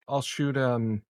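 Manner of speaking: normal talking voice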